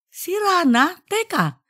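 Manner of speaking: high, startled wife's voice; surprised wife. Acted. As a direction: catch herself, pitched high. Wife reacts to broken fan.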